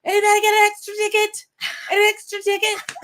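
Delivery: high-pitched reenacted crowd call. Asking the ticket line for a spare, retold calling out.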